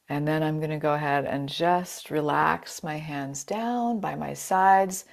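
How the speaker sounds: usual speaking voice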